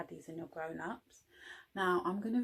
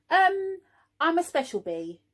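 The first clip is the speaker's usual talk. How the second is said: Norman fibbing; 8 semitones higher, 7 dB louder than usual. Acted. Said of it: "said Norman".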